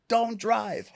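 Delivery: high frantic shout of don't drive; panicked scream. Retold or imitated. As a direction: beg him to stop, screaming high. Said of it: "So I'm screaming".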